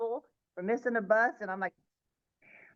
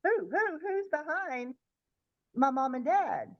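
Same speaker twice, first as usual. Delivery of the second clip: mildly alarmed, higher-pitched questioning; scared grandmother; 7 semitones higher than usual. Retold or imitated. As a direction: stammer out a worried, high question. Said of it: "I think that scared her".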